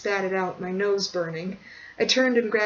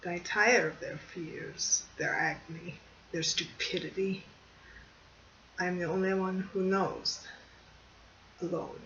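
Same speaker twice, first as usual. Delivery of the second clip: a hint of a tired old man; weary old man; 8 dB quieter than usual. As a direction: let each phrase sink, tired of the world. Old man speaking, soft, slow.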